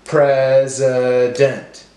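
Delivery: pres... i... dent, slowly; broken into syllables. Shown as a demonstration. Teacher helping class count syllables, slow and segmented. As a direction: separate each syllable evenly. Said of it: "break up our words into syllables".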